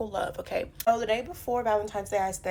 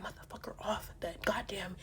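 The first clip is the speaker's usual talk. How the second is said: whisper; 2 semitones lower, 13 dB quieter than usual. Retold or imitated. Telling the crowd how she ripped the plate off, whispered. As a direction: hiss the words through your breath. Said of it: "unvoiced whisper".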